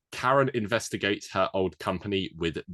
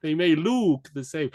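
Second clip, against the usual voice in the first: put-on high, loud hammy Italian accent; mock Italian gangster advice; Italian mobster voice; 12 semitones higher, 7 dB louder than usual.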